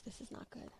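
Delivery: breathless whisper under her breath; real whispered dismay. Real, not acted. Coach spilling coffee near her gear, whispering in dismay. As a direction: whisper it, caught off guard.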